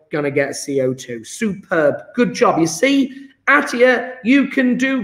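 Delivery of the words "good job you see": ordinary talk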